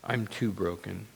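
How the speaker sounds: low, very soft admission; broken inner voice. Acted. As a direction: murmur it quietly from a place of defeat. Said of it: "this voice coming from our brokenness".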